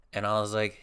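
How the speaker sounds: ordinary delivery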